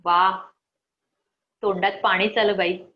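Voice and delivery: ordinary talk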